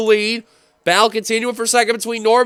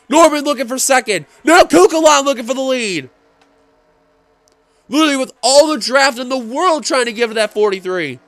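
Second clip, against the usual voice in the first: excited; 5 semitones higher, 4 dB louder than usual. Real, not acted. Finish of race, rising call.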